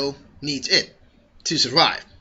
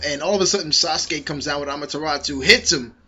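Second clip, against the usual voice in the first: usual speaking voice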